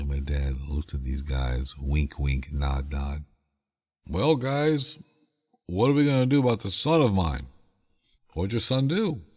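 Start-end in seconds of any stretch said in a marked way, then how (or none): 4.1-7.5 a lightly voiced dad, pitched higher and chummy; dad's voice. Dad to the burly guys about his runaway son, lightly voiced higher. 8.3-9.2 a lightly voiced worker, pitched higher; burly worker. Worker asks the dad about the boy, lightly voiced higher.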